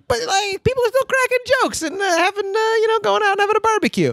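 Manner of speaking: high cheery voice. Retold or imitated. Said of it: "But you're also like".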